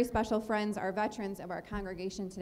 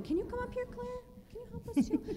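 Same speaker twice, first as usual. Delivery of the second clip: high, sing-song invitation; sing-song call; 13 semitones higher, 5 dB quieter than usual. Real, not acted. Teacher inviting Claire up in a high sing-song. Calling a child up.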